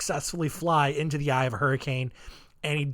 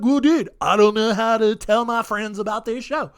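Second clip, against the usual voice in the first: higher, louder put-on dude voice; hammed-up dude complaining, pitched up and loud; mock clueless listener; 5 semitones higher, 5 dB louder than usual. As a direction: imitate a whiny, clueless fan. Voicing a hesitant listener.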